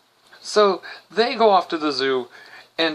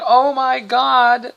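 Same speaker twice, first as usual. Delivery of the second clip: put-on excited kids; 7 semitones higher, slower than usual. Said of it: "come running back going".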